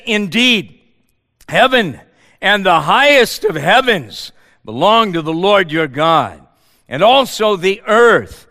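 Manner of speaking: loud scripture reading. Acted. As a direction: proclaim the text to the church. Reads Deuteronomy aloud.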